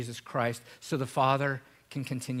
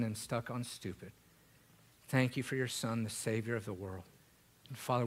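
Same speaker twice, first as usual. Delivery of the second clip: soft, hushed closing prayer; 5 dB quieter than usual. Acted. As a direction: pray quietly over the room.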